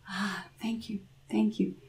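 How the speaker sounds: soft breathy whisper; whispered thanks. A narrator's reading. Grandmother whispering thanks to the devil. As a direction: thank him in a hush.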